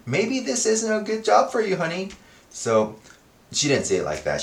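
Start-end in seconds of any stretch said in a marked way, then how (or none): 0.0-2.1 wife quote. Retold or imitated. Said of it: "she's like".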